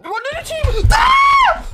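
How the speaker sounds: very high-pitched shout into a scream; frantic shouting. Real, not acted. Player in a firefight with teammates, frantic shouting. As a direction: yell in the heat of combat.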